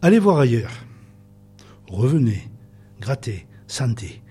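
slow, measured recitation with long pauses; poem reading. Acted. Guest reciting a Delteil-style truffle recipe on air, poetic reading. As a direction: read each instruction like a line of poetry.

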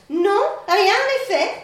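assurance arrogante, plus haut et plus fort; arrogance. Acted. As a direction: bomber le torse en répondant. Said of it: "avec une certaine arrogance". (le garçon répond avec arrogance, plus fort)

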